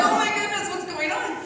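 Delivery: high, loud gasp of disbelief; stunned. Retold or imitated. Sermon hits home, so she blurts it in high shock.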